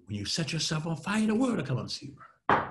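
quick, engaging run without shouting; fast, held-in delivery; low and fast. Shown as a demonstration. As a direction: rattle it off in one keen, contained rush. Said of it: "Low and fast is for engagement".

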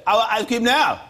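high, loud imitation of a demanding child; shouting four-year-old. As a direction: yell it like a toddler with no manners. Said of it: "he thinks you have to shout".

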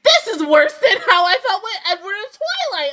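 loud, high-pitched confession through laughter; laughing self-mockery. Real, not acted. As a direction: wail it while laughing at yourself. Laughing at own new crush.

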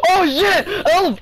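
sudden high yelp; startled yell. Real, not acted. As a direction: yelp in shock. Zombie jumps on him.

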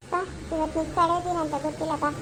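low, fast menacing lure; sinister voice. Acted. Unseen menace luring the child on her last day, low and fast.

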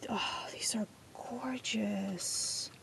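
slow breathy whisper of awe; awed whisper. Real, not acted. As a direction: whisper it slowly, amazed.

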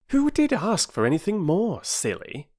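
high, emphatic sibling comeback; indignant retort. Acted. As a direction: scoff at your sister's silly question. Brother retorts to his sister.

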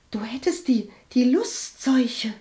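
hesitant, slowing secretive whisper. A narrator's reading. Cousin confides shameful rumour near family, whispering. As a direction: whisper it haltingly, as a scandalous secret. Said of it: "flüsterte Walburga".

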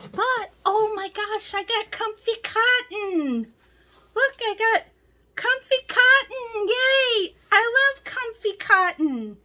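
excited cheer. Real, not acted. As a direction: cheer for the comfy cotton. Finds favorite yarn, cheers.

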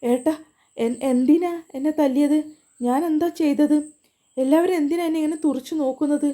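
hurt protest. A narrator's reading. Slapped sister questions her brother. (അടികൊണ്ട വേദനയിൽ ഉയർന്ന സ്വരത്തിൽ ചോദ്യം)

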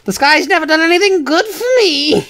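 mock grievance. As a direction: whine it up high like a sworn enemy. Mock grievance against the sky.

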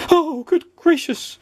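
slow, very high wail of alarm; panicked cry. A narrator's reading. Mister Jelly startled by his cornflakes, panicked cry. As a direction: draw out each exclamation in a squeaky panic. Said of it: "cried Mister Jelly".

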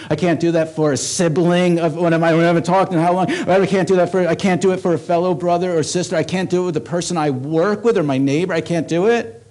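loud, rising, insistent sermon; preaching at full force. Acted. Pastor listing who we can't love, loud and building. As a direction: hammer each I can't harder.

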